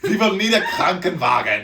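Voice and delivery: very loud, high mock German accent. Topping own dirty-talk joke, loud mock German accent. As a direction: shout it in a hammed-up German accent.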